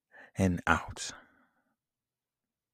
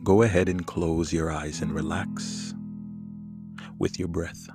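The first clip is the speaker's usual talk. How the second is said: calm and soothing over the music. Acted. Leads a guided meditation.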